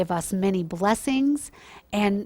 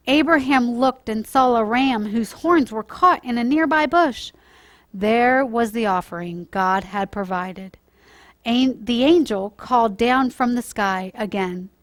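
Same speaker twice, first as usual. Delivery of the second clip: put-on storytime voice for children, lively and clear; gentle storyteller reading to kids; story narration; 2 semitones higher, 7 dB louder than usual. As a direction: read it like a bedtime Bible story.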